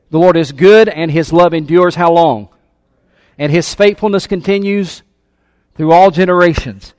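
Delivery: loud, driving preacher's voice; fervent sermon delivery; preaching at full force. Acted. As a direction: preach it out to the church. Preaching to congregation.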